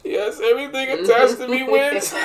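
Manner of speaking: high, giddy laughing exclamation; laughing joy. Retold or imitated. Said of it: "I just stood there like".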